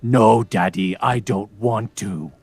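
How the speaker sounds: imitating a daughter straining for a low register; slow, loud mock-deep refusal; child faking deep voice. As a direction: copy a kid pushing the voice down, drawn out. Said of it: "trying to go down into that lower register".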